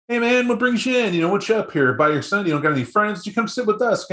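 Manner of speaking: high, overfriendly invitation to sit down; friendly coaxing invite. Retold or imitated. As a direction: coax the stranger over like an old pal. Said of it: "You try to ask him about".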